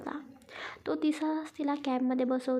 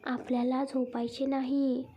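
ordinary delivery

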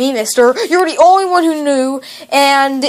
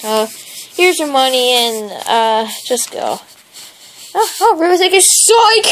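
ordinary talk, nothing marked

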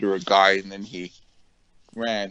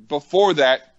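normal talking voice

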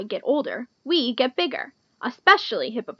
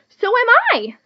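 high, loud chiming in; eager pollywog; 11 semitones higher, 7 dB louder than usual. A narrator's reading. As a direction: pipe up proudly as the little pollywog. Pollywog eagerly answers the boast.

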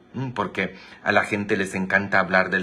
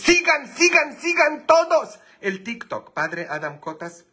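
high, loud hype shout; loud high livestream hype; excited shout; 3 dB louder than usual. Real, not acted. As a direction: yell at the viewers to subscribe. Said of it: "demanding follows".

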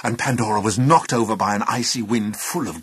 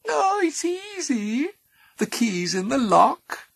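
high, slow purring voice; purring little voice; 4 dB louder, slower than usual. Acted. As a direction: purr it like a tempter. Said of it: "a purring little voice".

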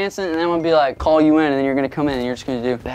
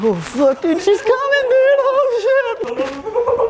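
mock panic; much slower than usual. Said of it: "I'm like".

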